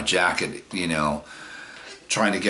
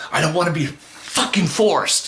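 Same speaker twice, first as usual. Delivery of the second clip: higher pitched angry outburst; retold yell; 10 semitones higher than usual. Pushed-away guy yelling at the girls, angry. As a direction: snap back, much higher. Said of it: "I yelled it really loud".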